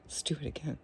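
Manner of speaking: conspiratorial whisper. Real, not acted. Mischievous whisper to the viewers, wanting another zap. Hushed after the scary zap.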